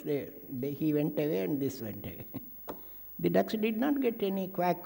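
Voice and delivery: ordinary talk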